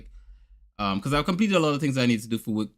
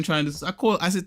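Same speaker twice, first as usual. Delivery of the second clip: ordinary delivery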